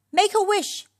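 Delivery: high, urgent yell; loud, high-pitched command. A narrator's reading. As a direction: yell it before time runs out. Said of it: "yelled Red".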